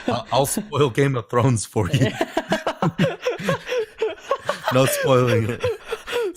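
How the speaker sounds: laughing through the words; giggling threat. Real, not acted.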